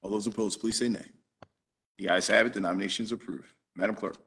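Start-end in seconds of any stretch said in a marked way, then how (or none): none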